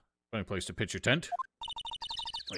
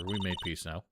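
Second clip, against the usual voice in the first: ordinary talk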